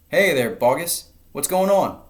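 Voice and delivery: loud, high call from far above. A narrator's reading. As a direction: shout down into the pit. Crowd calls down into crater.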